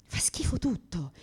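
soft, slow whisper; whispered despair. Real, not acted. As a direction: confess it under your breath. Whispered confession.

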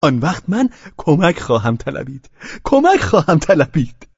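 high, mocking threat; play-acting taunt. A narrator's reading. Pavel toying with Velchaninov, threatening to call help, mocking.

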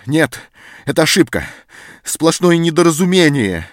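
loud and high, hotly insisting; heated protest. A narrator's reading. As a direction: protest it's all a mistake, fired up. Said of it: "запальчиво перебил его Антон".

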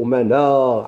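normal talking voice

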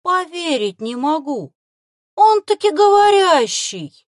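high, loud, slow rising wonder; can't believe his eyes; amazed. Acted. Boy marvels at the talking fox, thrilled.

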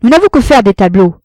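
loud, curt and harsh; brutal retort. A narrator's reading. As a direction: cut him down bluntly. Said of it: "dit brutalement". (réplique brutale)

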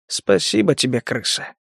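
soft menacing whisper; hushed, fading to breath. Acted. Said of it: "заметил Гектор уже шёпотом".

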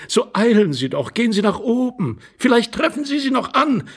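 high, rising feminine insistence; urging lady. Acted. Lady sending the intruder upstairs, high and urgent.

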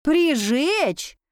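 «Прижечь?» pitched high, as a startled question; incredulous echo. A narrator's reading. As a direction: repeat «Прижечь?» in disbelief. Heroine echoes the word in disbelief.

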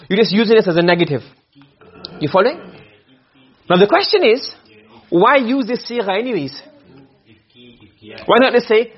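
usual speaking voice

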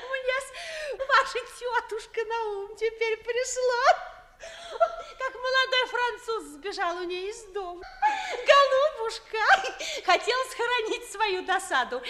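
high-pitched, talking through giggles; helpless laughter. Acted. Maid tells mistress about her aunt, laughing helplessly. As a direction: tell it while bursting with laughter. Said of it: "этот смех дурацкий".

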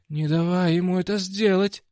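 high-pitched cry of warning; urgent shout. A narrator's reading. Максвелл warns the fighter mid-fight, urgent shout. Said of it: "крикнул Максвелл".

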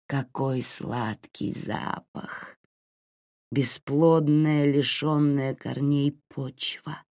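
slow, savouring, unsettling creature voice; eerie possessed voice. Acted. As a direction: relish every word like a hungry thing. Possessed man savours the smell.

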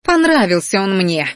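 petulant, put-on girl's voice; defiant retort. A narrator's reading. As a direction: throw it back defiantly. Adept defiantly snaps back.

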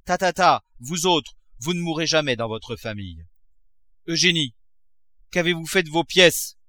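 angry cry. A narrator's reading. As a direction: brush them off, then round on the daughter. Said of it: "cria-t-il en fondant sur elle".

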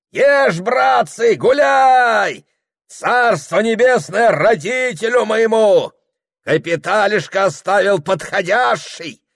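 very high, loud bellowing; roistering shout. Acted. Host urging the guests to revel, shouting boastfully. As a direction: bellow to the whole feast.

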